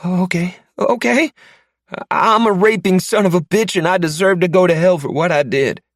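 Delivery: high, loud frightened recital of the forced words; terrified captive repeating. Acted. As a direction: gabble the confession out in a scared high voice. I want you to say.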